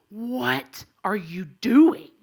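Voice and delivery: loud, very slow mock policeman; stern officer. Officer confronts woman smoking, loud drawn-out reproach. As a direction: stretch each word in scandalized authority.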